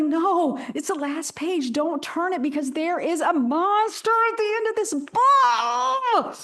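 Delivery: high, slow panicky Muppet monster; stressed-out Grover. Retold or imitated. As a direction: plead high and drawn out, in a panic. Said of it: "You know, in the Grover voice".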